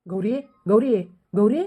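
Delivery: calling across the field for Gauri; a husband calling his missing wife. Acted. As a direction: call her name out, searching.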